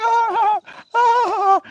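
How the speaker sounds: high-pitched mock wail, drawn out. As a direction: play a cartoonish cry of alarm, held high. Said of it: "You'll be like".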